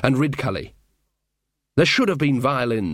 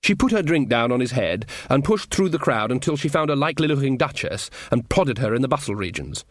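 plain reading voice

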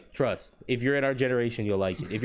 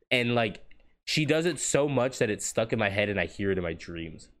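normal talking voice

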